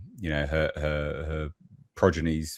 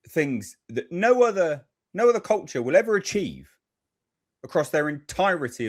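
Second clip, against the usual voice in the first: higher-pitched, impassioned praise; rising fervour; 6 semitones higher than usual. Real, not acted. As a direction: swell up with pride. Building rant about empire.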